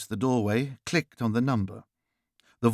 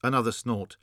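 plain reading voice

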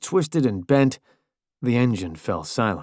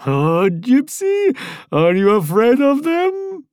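much higher, slow sing-song jeer; taunting Italian voice; 13 semitones higher, 4 dB louder, slower than usual. A narrator's reading. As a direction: needle him mercilessly.

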